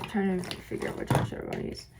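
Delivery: soft unvoiced whisper to herself; whispered aside. Real, not acted. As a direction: whisper it under your breath, thinking aloud. Quiet aside while doing makeup.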